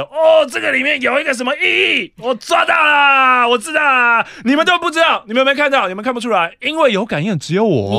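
shouting self-proclaimed psychic; hammed-up mystic rant; imitating the mystic. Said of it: "你不要模仿我".

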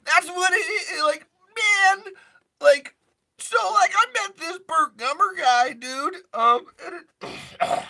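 very high, strained stoner voice; cartoon stoner on the verge of a crack; stoner voice just above crack. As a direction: push the voice right to the edge of cracking. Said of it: "your voice is just above crack".

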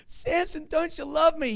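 high, slow, loud fake sobbing plea; hammed-up tearful pleading to Samson; mock crying. The bride wails at Samson to get the riddle, in hammed-up mock crying. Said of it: "she starts crying".

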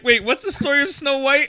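laughing. Retold or imitated. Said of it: "you're like".

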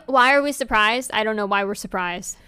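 ordinary delivery

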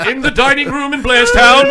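loud, high scene-change narrator; mock announcer. Co-host parodying old Batman transitions, loud grand announcer. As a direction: boom the location dramatically.

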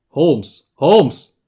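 loud call, rising on the second name. A narrator's reading. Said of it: "rief Herr Smith".

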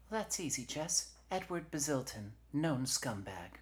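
high-pitched helpful lieutenant; Varna. Acted. Lieutenant gives Jesse a suspect's name, high and businesslike. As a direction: answer readily, pitch well up.

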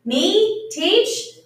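high, loud startled questions; taken aback. Acted. Housekeeper suddenly named the teacher, astonished.

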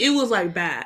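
ordinary delivery

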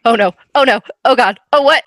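rising alarmed cries of oh no; escalating alarmed exclamations; panicked oh no. Retold or imitated. Panicked reaction to accident.

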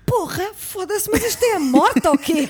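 slow, high, stunned swearing; shocked outburst. Retold or imitated. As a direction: recoil at the freezing room. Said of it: "a minha reação foi".

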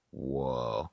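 low, soft stoned whoa; dazed realisation. Retold or imitated. Smoker home after the party, dazed realisation. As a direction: let it sink out quietly.

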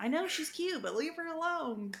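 high scolding. Retold or imitated. Retold scolding continues.

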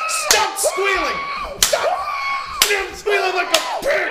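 shouting. Acted. Yelling at squealing friend.

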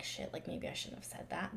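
soft unvoiced murmur of regret; hushed mock regret. Friend imitating a contestant's quiet regret. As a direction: mutter it under your breath like a guilty aside. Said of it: "later been like".

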